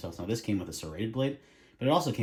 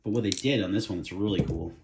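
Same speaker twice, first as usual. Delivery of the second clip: ordinary talk, nothing marked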